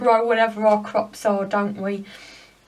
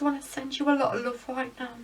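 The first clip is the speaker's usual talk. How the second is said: choked up; 3 semitones higher, 5 dB quieter, faster than usual. Real, not acted. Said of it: "Sorry, I got choked up then".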